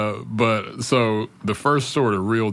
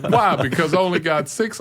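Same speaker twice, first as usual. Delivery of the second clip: much higher, whiny, baffled boss; mock boss voice; 8 semitones higher, 4 dB louder than usual. Retelling the boss's reaction, high whiny mock voice. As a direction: squeal it like a caught-out boss.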